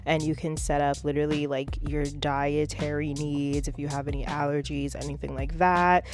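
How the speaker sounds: upbeat ad read over music; a light promo voice; sponsored ad read. Acted. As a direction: sell the meal service like a host.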